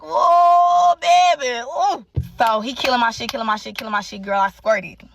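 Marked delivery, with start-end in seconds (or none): 0.0-2.0 loud high New Orleans drawl imitation; New Orleans accent. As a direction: call out like a New Orleans man. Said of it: "he from New Orleans, so his voice, that accent".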